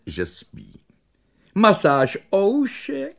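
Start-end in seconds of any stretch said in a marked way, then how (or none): 1.5-3.2 high, slow sweet question; fawning offer. Acted. Shopkeeper offering the dogs an ear massage, high slow coo.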